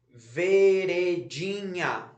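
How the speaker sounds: "Veredinha" drawn out very slowly, ve-re-di-nha; syllable by syllable. Shown as a demonstration. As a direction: stretch each syllable of "Veredinha". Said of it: "separar em sílaba". ("Veredinha" bem devagar, sílaba por sílaba)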